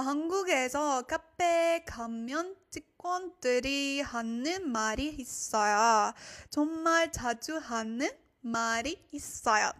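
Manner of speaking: fast model reading of the sentence. Shown as a demonstration. Teacher reading the sentence fast for learners. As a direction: rattle it off at native speed. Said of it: "repeat faster and then slower".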